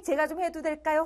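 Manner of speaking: ordinary delivery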